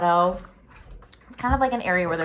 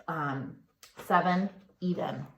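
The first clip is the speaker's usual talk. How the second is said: normal talking voice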